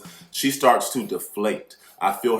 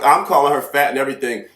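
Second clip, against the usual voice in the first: usual speaking voice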